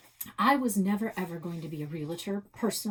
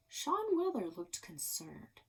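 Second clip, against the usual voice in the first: ordinary delivery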